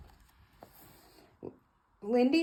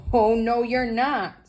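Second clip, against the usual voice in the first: higher, louder no; loud refusal; 5 semitones higher, 7 dB louder, slower than usual. Acted. George refusing the doctor at the door, loud no. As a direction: bark the refusal.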